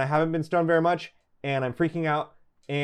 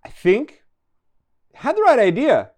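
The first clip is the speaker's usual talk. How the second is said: panicked realization; 7 semitones higher, 6 dB louder than usual. Acted. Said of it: "I'm like".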